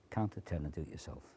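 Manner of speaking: very soft, low, fast murmur right in his ear; real ear volume. Shown as a demonstration. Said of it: "If you want the real volume of this".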